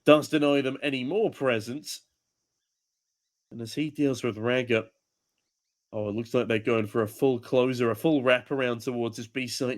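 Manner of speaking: ordinary delivery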